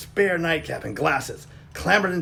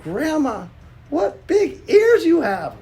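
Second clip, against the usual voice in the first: put-on curious little girl; 5 dB louder than usual. Girl speaks to grandma.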